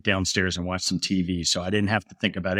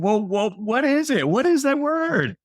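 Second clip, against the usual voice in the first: very high, drawn-out mock-giddy questioning; giddy, retold; slower than usual. Dad begging his giggling daughter for the word, high-pitched and giddy. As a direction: act out helpless curiosity, voice soaring. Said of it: "now it's a little bit uncontrolled".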